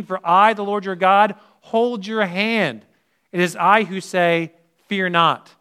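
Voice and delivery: emphatic sermon reading of Isaiah; preaching at full force. Acted. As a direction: declare the answer with conviction. Preacher answering with scripture.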